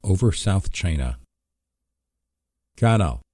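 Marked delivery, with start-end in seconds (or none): 2.8-3.2 chirped. Acted. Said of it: "Honker's voice chirped".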